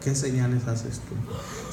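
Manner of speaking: low, soft, slow challenge; skeptical question. Retold or imitated. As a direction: ask it doubtfully, quietly. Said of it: "lo que después le dicen".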